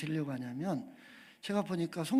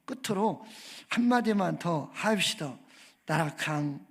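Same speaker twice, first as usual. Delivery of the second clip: comic Gyeongsang dialect prayer, louder and punchy; 경상도 사투리로 투박하게 외치는 주기도문; 6 dB louder than usual. Pretend. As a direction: pray like a blunt Gyeongsang man for laughs. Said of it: "그런데 이제 경상도 버전".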